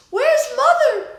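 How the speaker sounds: high, loud panicked call; frantic yell. A narrator's reading. Mama yelling for Grandma at the burning house, loud high shout. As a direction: shout it out, scared for her mother. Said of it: "Mama yelled".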